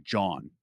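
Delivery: "John" as JAWN, dropped low; Philly slang jawn. Shown as a demonstration. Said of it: "a lot of people will get wrong in Philly".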